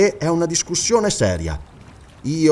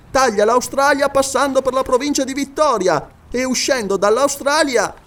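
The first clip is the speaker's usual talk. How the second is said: higher, steady scholarly recitation; high eager scholar listing places from memory; Paganel reciting; 8 semitones higher than usual. Acted. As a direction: rattle off the map like a proud geographer. Narrator voices Paganel.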